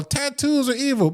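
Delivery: mock protester. Mocks imagined tattoo-parlor protester.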